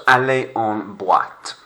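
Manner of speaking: aller en boîte with boîte as bwat; careful French. Shown as a demonstration. Teacher repeats phrase for class, careful French. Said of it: "It's not trois".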